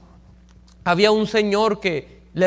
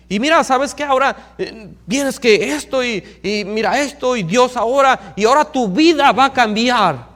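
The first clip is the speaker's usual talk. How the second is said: fervent preaching; 4 semitones higher, 5 dB louder than usual. Retold or imitated. Pastor preaching hard to the man.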